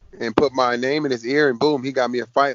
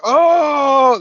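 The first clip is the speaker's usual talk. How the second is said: loud, high, drawn-out whoop; long mock roar of amazement, pitched way up; crowd going nuts; 13 semitones higher, 7 dB louder than usual. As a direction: imitate a whole room erupting. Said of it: "the whole studio went nuts".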